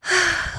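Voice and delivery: soft sigh of giving in; resigned sigh. Acted. Father giving in to Taya, resigned sigh. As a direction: let out a sigh of surrender.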